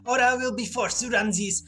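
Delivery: menacing Russian spy interrogator; loud, gloating villain threat; KGB agent voice. Acted.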